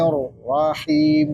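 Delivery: BISMILLAHIRRAHMANIRRAHIM broken up, each double L and R held; measured, syllable by syllable "Bismillahirrahmanirrahim"; model recitation. Shown as a demonstration. Teacher showing how it is read, deliberate doubled letters. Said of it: "bacanya gini".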